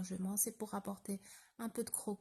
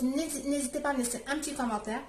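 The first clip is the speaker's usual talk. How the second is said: words blurred by a mouthful of food; mouth full; 5 semitones higher, 7 dB louder, much faster than usual. Real, not acted. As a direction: rush it out while still chewing. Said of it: "je parle la bouche pleine".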